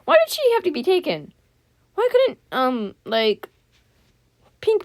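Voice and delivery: high, loud squeal of protest; squeaky, upset objection; complaining protest. Real, not acted. Complaining about who was taken.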